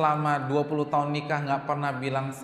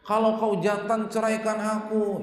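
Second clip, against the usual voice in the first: mock furious wife; 7 semitones higher, 4 dB louder than usual. Wife screaming at husband.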